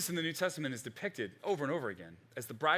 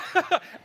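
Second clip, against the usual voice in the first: laugh; 8 dB louder than usual. Real, not acted. Laughing at own skit.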